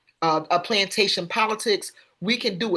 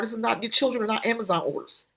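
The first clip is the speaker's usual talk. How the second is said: ordinary talk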